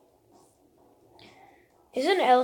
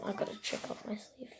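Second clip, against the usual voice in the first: whispering; 3 semitones lower, 11 dB quieter than usual. Real, not acted. Muttering to self.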